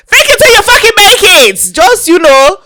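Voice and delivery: high, loud yelled motto; emphatic shout. Real, not acted. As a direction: holler it like a rallying cry. Loud motto climax of bar story.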